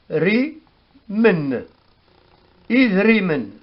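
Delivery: slow, careful Berber word "Is riemen"; Berber word demo. Shown as a demonstration. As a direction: say "Is riemen" syllable by syllable. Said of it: "Par exemple, dit".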